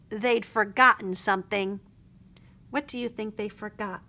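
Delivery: ordinary delivery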